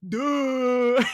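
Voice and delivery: loud, high dopey mock voice; drawn-out dopey "duh"; mock dimwit. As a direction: imitate a clueless protester, dumb and loud. Mocking the lady's dim answer.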